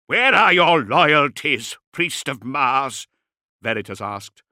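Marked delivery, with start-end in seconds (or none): 0.1-3.1 slow, loud, high accusation; inquisitor's raised-voice rebuke; stern challenge. A narrator's reading. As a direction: demand it forcefully, drawing out each word. Inquisitor challenges distracted priest.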